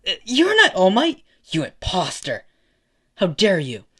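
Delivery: loud, high outburst; outraged kid, voice jumping up; indignant accusation. Acted. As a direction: accuse an impostor in shock. Kid accuses a supposed impostor.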